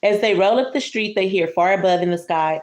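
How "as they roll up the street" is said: ordinary delivery, nothing marked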